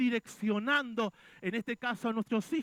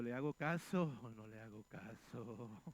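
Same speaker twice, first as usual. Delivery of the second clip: mock hesitation; 11 semitones lower, 9 dB quieter than usual. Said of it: "yo digo".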